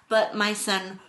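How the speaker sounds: usual speaking voice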